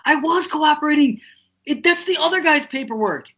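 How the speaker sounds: high-pitched retold protest; exasperated insistence. Drummer correcting his doubting lawyer, exasperated insistence. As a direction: push back, voice way up.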